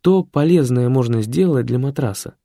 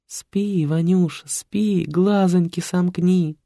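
lullaby voice; 9 semitones higher, slower than usual. Acted. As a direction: croon him to sleep. Caring program lulls Vanya.

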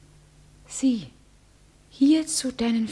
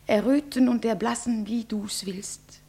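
ordinary delivery

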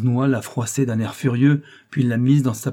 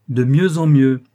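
a louder cry of delight; eager exclamation, clearly louder; exclaiming; 3 semitones higher, 7 dB louder than usual. A narrator's reading. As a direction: burst out, pleased the case is coming together. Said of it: "s'écria-t-il".

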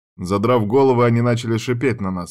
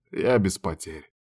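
plain reading voice

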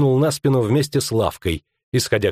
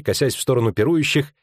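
plain reading voice

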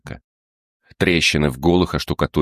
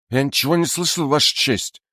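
much higher frightened whine; scared whining; 10 semitones higher than usual. Acted. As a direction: whimper it out in terror. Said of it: "заскулил перепуганный портной".

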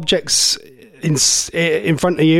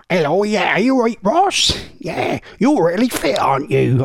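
swooping caricature voice; put-on chatty voice that swoops upward; Josie Gibson; 3 semitones higher than usual. Host imagining a TV presenter flirting with him, caricature voice. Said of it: "All right, Josie, just don't, just don't talk".

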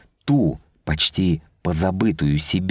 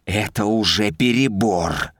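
hissing; 3 semitones higher than usual. A narrator's reading. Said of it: "прошипел он".

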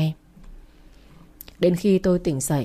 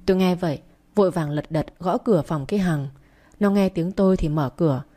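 ordinary delivery, nothing marked

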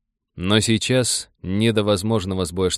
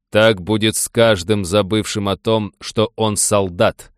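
slow, louder, each word hammered; clipped, measured words; 4 dB louder, slower than usual. A narrator's reading. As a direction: beat out every word slowly. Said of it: "отчеканил Рам".

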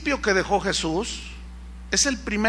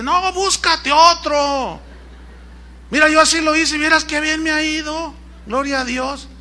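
foolish commenter; 6 semitones higher than usual. Retold or imitated. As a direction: savor bad advice like a silly churchgoer. Foolish commenter gives advice.

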